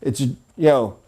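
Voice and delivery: usual speaking voice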